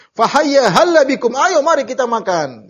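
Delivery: high, loud call to come and eat; imitating a shouted invitation; retold shout. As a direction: summon the whole crowd to a meal. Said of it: "Nabi teriak".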